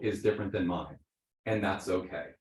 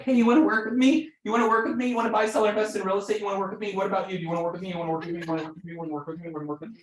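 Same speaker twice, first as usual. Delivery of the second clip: pushy agent pestering; 9 semitones higher, much faster than usual. Pretend. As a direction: pester everyone, then lose steam. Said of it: "be like".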